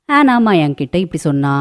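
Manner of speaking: plain reading voice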